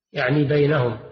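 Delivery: usual speaking voice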